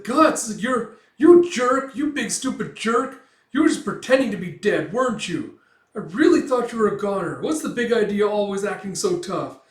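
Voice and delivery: high, loud upset outburst; worked-up little character yelling; tearful scolding. Retold or imitated. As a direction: berate a friend through mock tears. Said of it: "tears streaming down Puck's face".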